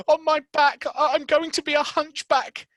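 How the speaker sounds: very high, loud panicky wail; sick boy fearing he is a hunchback; terrified boy. Acted. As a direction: cry out in dread. Colin terrified.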